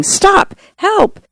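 high-pitched cry for help at reading volume; mild shout. A narrator's reading.

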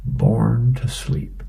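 drowsy, drawn out; sleepy. Acted. Snoopy's sleepy punchline.

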